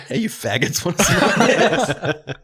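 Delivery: mock loud slow taunt laughing; retold friend, loud. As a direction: belt it like a goofy teen. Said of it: "He was talking to a pack of cigarettes".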